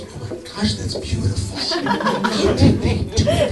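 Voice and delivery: breathy whispered gush, rising high at the end; hushed, awed praise of a child's painting; mock gushing admirer. Onlookers gushing over a child's painting in an awed whisper. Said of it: "everybody goes".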